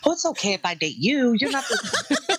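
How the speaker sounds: laughing mock gushing voice; mocking quote. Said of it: "I've always been told".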